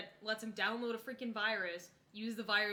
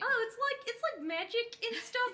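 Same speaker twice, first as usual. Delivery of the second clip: high airheaded mock voice; ditzy voice; 13 semitones higher, 5 dB louder than usual. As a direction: play the clueless author, high-pitched. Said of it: "she would just talk about something".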